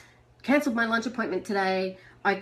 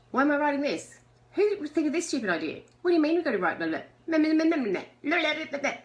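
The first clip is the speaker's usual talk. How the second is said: higher, mock-whiny complaining voice; whiny inner critic; 7 semitones higher, much faster than usual. Mocking her own early journal pages, in a higher whiny voice. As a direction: whine like a grumpy sceptic being made to write. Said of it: "my first ones were".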